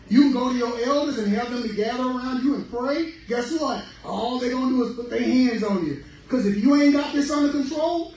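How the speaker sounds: loud, high sermon call; rising sermon fervor; fiery preaching. Real, not acted. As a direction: preach hard at the church. Preaching at full force.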